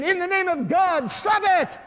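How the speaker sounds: high, loud cry; urgent shout. Acted. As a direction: shout to halt the fight. Monk shouts again at the arena.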